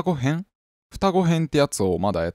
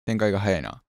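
usual speaking voice

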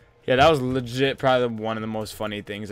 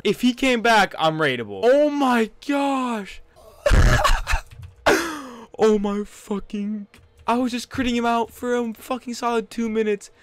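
loud, high, disbelieving shouts with laughter; shocked yelling; 10 semitones higher, 7 dB louder than usual. Real, not acted. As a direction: yell at the stream in shock. Near-miss, shocked gamer yelling.

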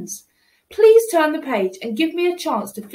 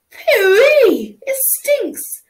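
high loud yuck at a bad smell; high pinched voice of disgust; disgusted cry; 9 semitones higher, 6 dB louder, much slower than usual. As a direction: recoil from the stink.

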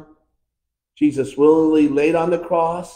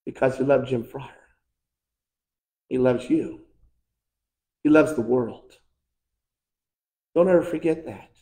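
softer, lower and gentle; tender, soft; 3 semitones lower, 4 dB quieter than usual. Acted. Preacher reminding each listener that God loves them, soft and tender. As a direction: speak it softly, like a promise. Tender close after the climax.

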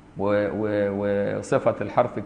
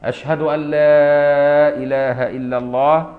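loud, slow, higher drawn-out adhan call; 3 semitones higher, 6 dB louder, slower than usual. Shown as a demonstration. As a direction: proclaim it slowly to the whole mosque. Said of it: "قايل بقى بصوت عالي". (نداء الأذان بصوت عالي وممدود)

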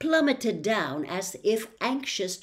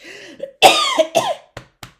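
raspy cough; 13 dB louder than usual. A narrator's reading.

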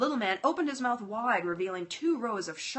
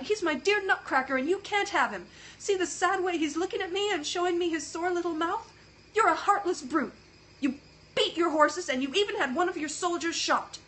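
high, loud tearful pleading; tearful girl; 9 semitones higher, 5 dB louder than usual. Acted. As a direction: defend the toy through tears. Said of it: "Marie was in tears".

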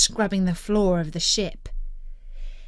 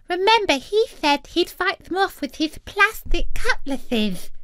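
put-on high little girl's lisp; lisping little sister; 9 semitones higher than usual. A narrator's reading. Second sister recalls Jack's boast, lisping. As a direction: turn every s into th, pitched up. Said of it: "said another".

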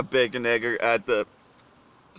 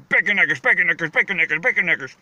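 "bacon eggers" rattled off faster and faster, slurring together; fast repetition; 4 semitones higher, 4 dB louder, faster than usual. Shown as a demonstration. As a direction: say "bacon eggers" quickly over and over until the words blur. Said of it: "you say it fast enough".

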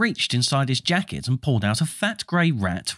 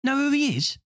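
put-on young boy voice; 11 semitones higher than usual. Young twin voiced in story.

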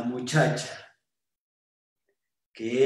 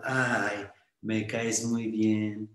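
lower, slow, coy purr; coy and smiling; 7 semitones lower, 4 dB quieter, much slower than usual. Acted. Smiling shop girl charms the nervous boy, lower and slow. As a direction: smile and charm him slowly. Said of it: "tenía una sonrisa muy grande".

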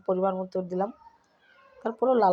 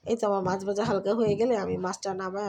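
usual speaking voice

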